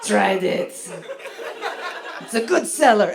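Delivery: badly faked old French prostitute accent, drifting Germanic; hammed-up, muddled European accent; botched French accent. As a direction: attempt French, land near Schwarzenegger. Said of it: "That's not a French accent".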